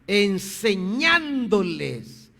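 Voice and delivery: "Enseñándoles" slow and stretched, falling at the end; drawn-out emphasis. Real, not acted. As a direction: drive "Enseñándoles" home, slowly. Repite la palabra clave al predicar. ("Enseñándoles" recalcado, lento y alargado)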